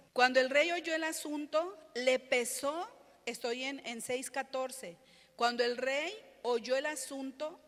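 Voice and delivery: loud, projected Bible reading; scripture reading. Acted. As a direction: read the verses out to the congregation. Reads scripture aloud forcefully.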